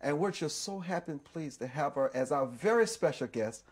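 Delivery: upbeat, projected show ident before the break; TV host announcement. Real, not acted. Host introducing his special guest to viewers before the break, announcer delivery. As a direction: roll through the guest's credits with presenter polish.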